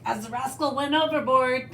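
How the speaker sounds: usual speaking voice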